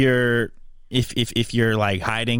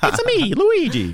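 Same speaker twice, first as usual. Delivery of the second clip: high, slow Luigi impression; 4 dB louder, slower than usual. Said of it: "me pretend to be Luigi".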